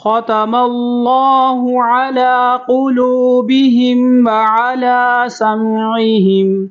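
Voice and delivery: slow, high melodic recitation of ختم اللہ علی قلوبہم; model recitation. Shown as a demonstration. Teacher recites so students can listen carefully, slow melodic chant. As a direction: chant it slowly for students to copy. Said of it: "میں پڑھوں گا، آپ نے سننی ہے".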